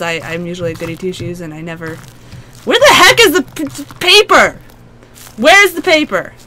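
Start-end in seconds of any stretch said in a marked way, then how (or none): 2.6-6.3 panicked. Real, not acted. Frantic search in horror game.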